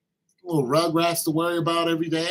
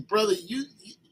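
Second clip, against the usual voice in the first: usual speaking voice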